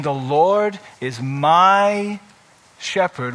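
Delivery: slow, low, weighty and sure; full assurance. Shown as a demonstration. Pastor showing confident personal faith, slow and assured. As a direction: lean into each word with deep conviction. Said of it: "with all kinds of assurance".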